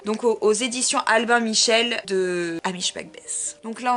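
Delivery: usual speaking voice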